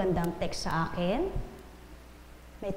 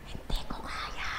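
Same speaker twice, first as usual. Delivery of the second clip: slow, drawn-out secret whisper; 7 dB quieter, slower than usual. Retold or imitated. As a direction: whisper it slowly to your seatmate. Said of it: "Bumulong ako sa katabi ko". (mabagal na bulong)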